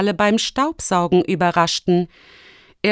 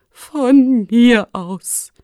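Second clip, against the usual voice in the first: laboured, very slow, raised pitch; gasped; 4 semitones higher, 5 dB louder, much slower than usual. A narrator's reading. Exhausted rector letting the children in, gasping. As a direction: drag it out with effort, worn out. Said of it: "keuchte Rektor Glauber".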